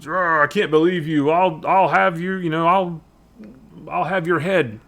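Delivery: half-hearted blustering threat; mild mock outrage. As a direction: play at being outraged. Acting out the angry soldier's threat.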